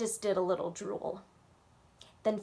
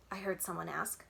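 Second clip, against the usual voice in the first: ordinary delivery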